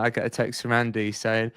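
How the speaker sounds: ordinary talk, nothing marked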